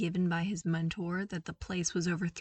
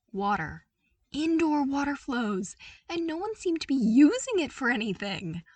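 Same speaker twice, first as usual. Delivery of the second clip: higher, louder, stressing indoor; excited stress on indoor, louder all through; delighted wonder; 5 semitones higher, 6 dB louder than usual. A narrator's reading. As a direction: gush over it in disbelief.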